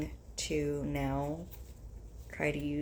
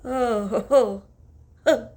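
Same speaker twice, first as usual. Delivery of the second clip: sneezing; 6 semitones higher, 11 dB louder, slower than usual. Real, not acted. Sneezing fit.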